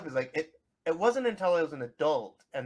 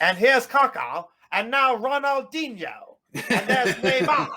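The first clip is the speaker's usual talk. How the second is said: high, drawn-out British commentator; hammed-up British accent, loud and stretched; British soccer announcer; 9 semitones higher, 6 dB louder, slower than usual. British announcer mangling Brazilian players' names, hammed-up British accent. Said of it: "British accents, the way they say".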